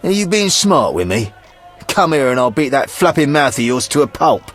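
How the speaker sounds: high-pitched thuggish menace; belligerent threat. Acted. Brawler to witcher who mocked him, belligerent threat.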